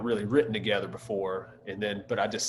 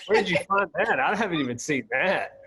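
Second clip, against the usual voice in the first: surprised laugh; 6 semitones higher, 5 dB louder than usual. Real, not acted.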